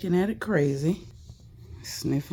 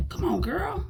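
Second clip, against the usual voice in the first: high pleading coax; 13 semitones higher than usual. Real, not acted. Pleading with the dog.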